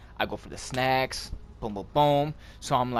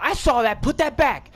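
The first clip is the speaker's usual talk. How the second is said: higher, louder scolding; scolding lady; 10 semitones higher, 4 dB louder than usual. Retold or imitated. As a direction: call him out like a store clerk. Pharmacy lady catches him.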